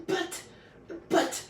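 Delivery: loud, broken sputter. A narrator's reading. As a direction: stumble on the words, flustered.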